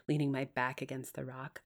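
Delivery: ordinary delivery, nothing marked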